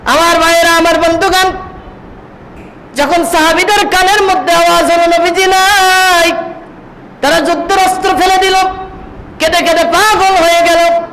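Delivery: high-pitched sermon delivery; impassioned preaching. Acted. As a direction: preach it high and urgent, lines held up at the top.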